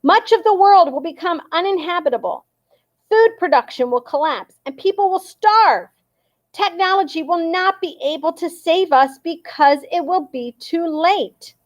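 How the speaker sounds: dad urging the crowd through a megaphone; loud megaphone speech. A narrator's reading. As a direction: proclaim it to the whole square. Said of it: "so loudly that everyone ... could hear".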